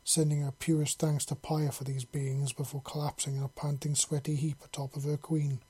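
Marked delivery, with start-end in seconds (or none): none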